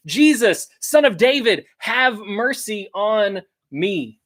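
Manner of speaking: mock screaming out. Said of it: "he just starts screaming out".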